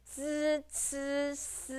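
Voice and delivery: "z, c, s" hissed at the teeth; teeth sounds. Shown as a demonstration. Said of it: "is actually the teeth sound".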